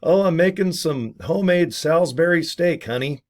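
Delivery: high, sugary fib to the spouse; fake sweet cover. Lying to spouse about dinner, mock sweet voice. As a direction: sell the lie brightly. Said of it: "and go".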